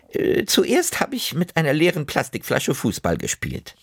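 hohe Omastimme, beiläufig berichtend; erzähl wie eine Oma von ihrem Streich; Oma erzählt. Acted. Oma erzählt, was sie tat. (Oma, hoch und plaudernd)

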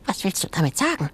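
very high, fast little animal voice; high fast creature voice; high squeaky creature. Acted. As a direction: chatter like a nervous jumping shrew. Sengi creature voice.

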